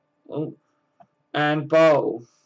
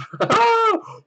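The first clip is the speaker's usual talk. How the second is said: high squealing laugh. Real, not acted. Streamer reacts to chat joke.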